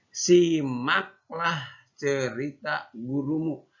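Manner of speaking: put-on slow, clear reading for children; reading aloud. Shown as a demonstration. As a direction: read it out slowly for first graders. Teacher reads textbook aloud.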